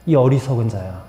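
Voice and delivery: low, understated divine rebuke; quietly voiced reproach to a fool; God's rebuke. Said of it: "하나님께서 그 부자에게 말씀하십니다".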